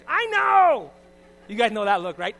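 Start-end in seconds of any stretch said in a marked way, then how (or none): 0.1-0.9 much higher and louder comic squeal; mock loud squeal. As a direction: blurt it out in a shrieking mock protest. Wife's defensive reaction joked about.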